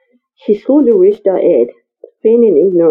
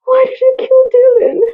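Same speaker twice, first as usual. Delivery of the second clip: breathless helpless. Acted. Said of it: "in a breathless, helpless tone".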